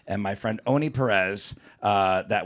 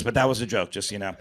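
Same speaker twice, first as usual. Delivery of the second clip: normal talking voice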